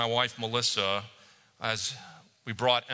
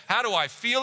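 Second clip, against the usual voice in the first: joyful cry; 9 semitones higher than usual. A narrator's reading. Sam wakes and greets Gandalf, joyful cry. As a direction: cry it out like waking to spring. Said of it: "he cried".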